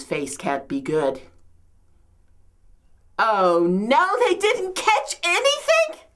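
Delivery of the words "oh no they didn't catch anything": high-pitched rising dismay; dismayed disbelief. Acted. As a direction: wail it as an incredulous question. Learns the nets are empty.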